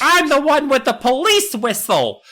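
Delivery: put-on high, smug little girl voice. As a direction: play the kid who has the whistle. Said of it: "Nessa's just like".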